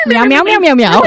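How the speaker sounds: high mock meows; cat meowing. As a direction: meow like a cat to drown him out. Mocking talker as a cat.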